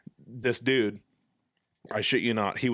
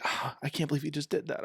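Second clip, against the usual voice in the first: softer, higher imitation of dismay; mortified wife; 5 semitones higher, 5 dB quieter than usual. Angler voices his embarrassed wife, soft dismayed reproach. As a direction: gasp it softly in mock mortification. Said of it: "she was hiding her face".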